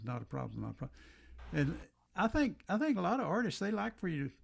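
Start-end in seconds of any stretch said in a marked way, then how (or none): 0.0-1.0 repeated, quieter and quieter; fading echo. Retold or imitated. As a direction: let it die away like an echo.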